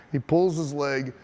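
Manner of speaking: ordinary delivery, nothing marked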